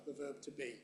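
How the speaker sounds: normal talking voice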